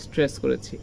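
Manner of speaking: normal talking voice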